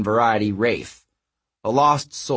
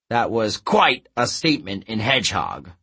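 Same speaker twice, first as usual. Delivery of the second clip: ordinary delivery, nothing marked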